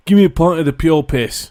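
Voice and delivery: a light mock British accent; a hint of a British pub order. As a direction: toss off a quick British accent as a daring drinker. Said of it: "I don't know why I made him British".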